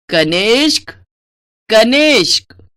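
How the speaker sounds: impatient mother calling her son. Acted. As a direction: call across the house for him.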